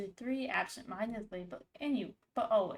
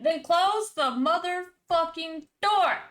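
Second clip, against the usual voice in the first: exasperated yell; 7 semitones higher, 8 dB louder, slower than usual. Real, not acted. Yells at game character.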